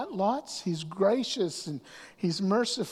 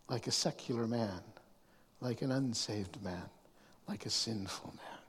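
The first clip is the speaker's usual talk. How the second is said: fading; 8 semitones lower, 9 dB quieter than usual. Real, not acted. Fading stern list.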